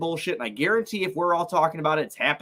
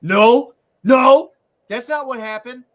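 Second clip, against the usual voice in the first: loud, high, exasperated no; louder, higher disbelieving correction; loud protest; 9 semitones higher, 8 dB louder than usual. Real, not acted. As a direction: push back hard and loud. Exasperated denial of the game recap.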